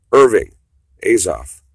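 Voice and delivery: loud call of Irving; shouting to get Irving's attention. Real, not acted. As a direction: call Irving loudly across the room. Calling out to Irving Azoff.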